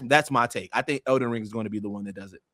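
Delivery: normal talking voice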